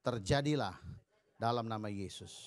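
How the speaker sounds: preacher dictating a prayer for the church to repeat; declaration prayer. Shown as a demonstration. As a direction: lead the room in a faith declaration, phrase by phrase.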